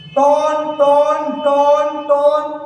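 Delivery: each टोन held long on one level note; ringing टोन sound. Shown as a demonstration. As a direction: chant टोन like an echoing chime. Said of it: "इससे एक ध्वनि उत्पन्न हो रही है".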